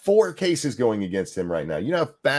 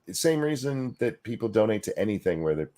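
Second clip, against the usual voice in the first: usual speaking voice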